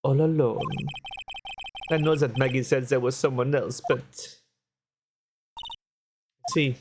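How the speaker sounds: lightly put-on French accent, café owner voice. Café owner telling the lawyer what happened, light French accent. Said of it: "I hate doing the accent".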